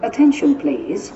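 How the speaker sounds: usual speaking voice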